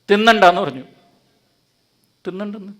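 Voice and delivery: normal talking voice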